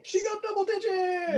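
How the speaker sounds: high-pitched celebratory shriek; real squeal of triumph at a dice roll; excited cheer. Real, not acted.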